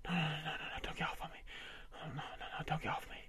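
soft, breathy begging; whispered pleading. Acted. Husband begging his wife not to stop, whispered. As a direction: plead under your breath.

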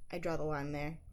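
ordinary delivery

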